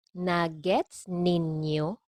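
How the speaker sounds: "Nagets ninyo" drawn out slowly; slow model. Shown as a demonstration. Teacher models phrase slowly, then normally.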